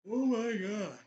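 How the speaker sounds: talking through a big yawn; mid-yawn, stretched out. Real, not acted. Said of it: "I'm sorry I'm yawning so much".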